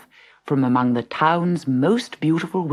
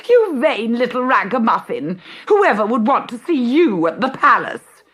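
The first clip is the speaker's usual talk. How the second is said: high, loud scornful jeer; jeering stepsister; 8 semitones higher, 5 dB louder than usual. Acted. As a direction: sneer at the ragged girl. Bertha jeers at Cinderella.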